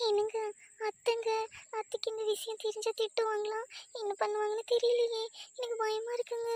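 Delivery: sweet girlish voice with a hint of fear; timid young wife. Wife telling husband she fears his mother, high and timid.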